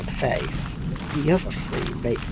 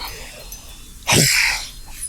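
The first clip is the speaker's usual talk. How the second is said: long tired breathy sigh; weary sigh. Acted. Tired traveller sighs before agreeing to go, weary sigh. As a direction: let out a heavy resigned sigh.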